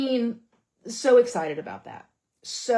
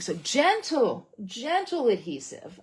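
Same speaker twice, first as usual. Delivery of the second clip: slow caution; 2 semitones higher, slower than usual. Shown as a demonstration. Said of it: "with this technique".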